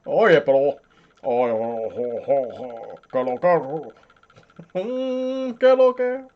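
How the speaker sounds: joking Buddha drone in Dominican Spanish; lightly voiced Dominican holy man, humming. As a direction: play a chanting Dominican Buddha. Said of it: "I'm Dominican Buddha".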